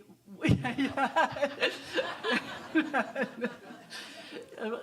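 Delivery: big nervous burst of laughter; long loud laughing fit. Real, not acted. Asking about Ram Dass, laughs.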